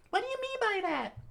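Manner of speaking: put-on high girl voice, demanding answers; Fuko demanding. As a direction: question the strangers sharply. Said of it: "Fuko asked".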